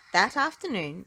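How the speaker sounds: usual speaking voice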